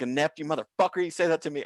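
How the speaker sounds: indignant, cursing comeback; angry retort. Retold or imitated. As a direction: throw the insult back at him.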